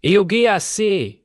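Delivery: high, loud command to stop; shouting. A narrator's reading. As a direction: break up the fight with a yell.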